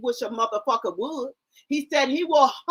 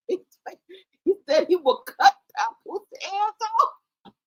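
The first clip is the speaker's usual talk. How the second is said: ordinary talk, nothing marked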